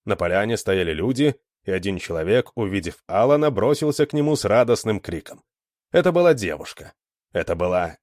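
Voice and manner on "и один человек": usual speaking voice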